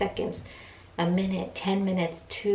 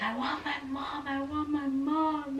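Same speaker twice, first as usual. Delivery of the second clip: crying; 8 semitones higher, 5 dB quieter, slower than usual. Retold or imitated. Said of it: "I felt so bad because I was crying".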